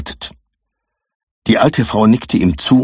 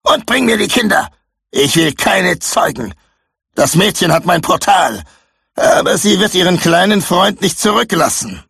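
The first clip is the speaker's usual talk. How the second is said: barking orders; 11 semitones higher than usual. Acted. Victorius shouting at Jack, high furious commands. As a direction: snap at your useless servant.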